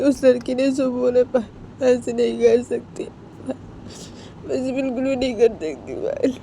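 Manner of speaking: high-pitched tearful pleading; crying. Acted. Poonam tells Payal she cannot do it, crying. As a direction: plead through tears, voice pitched well up. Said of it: "Poonam वो रो रही थी".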